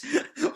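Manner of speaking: loud squealing laugh; real giggle fit; high burst of laughter. Real, not acted. Speaker cracks up.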